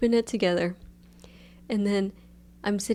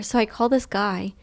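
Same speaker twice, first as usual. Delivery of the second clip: ordinary talk, nothing marked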